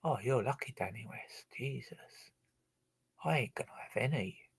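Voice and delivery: real hushed whisper. Real, not acted. Speaker to Danny West about something he got, whispering. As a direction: breathe it, no voice at all.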